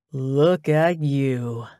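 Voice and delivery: very slow, drawn-out leer; lustful. A narrator's reading. Ex looking her up and down at the door, lustful. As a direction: ooze lust over each stretched word. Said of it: "lust oozing into his voice".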